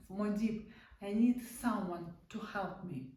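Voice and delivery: usual speaking voice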